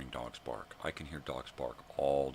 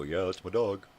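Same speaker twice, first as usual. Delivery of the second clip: loud, higher mocking boast; mock proud owner; 5 semitones higher, 10 dB louder than usual. Owner claims a dog kept just around, mocking boast. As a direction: imitate a careless owner showing off, much louder. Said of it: "so that you go".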